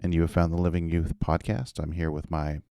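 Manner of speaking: usual speaking voice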